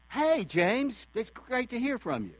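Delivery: bright, high "Hey, James", glad to hear him; light up with joy at the call; delighted greeting. Shown as a demonstration. Friend picking up the phone, thrilled to hear James. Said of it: "they can almost say the same thing".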